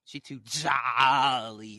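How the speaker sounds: a hint of a slow, drawn-out demon voice. Jealous girl's demon drags out the word, slow. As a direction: let the demon stretch out jolly. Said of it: "Her demon coming out right now".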